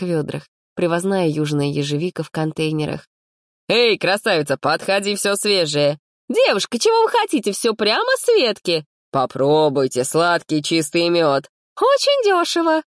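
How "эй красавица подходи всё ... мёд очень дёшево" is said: vendors calling out. Acted.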